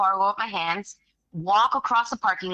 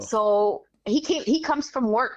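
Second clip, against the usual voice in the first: normal talking voice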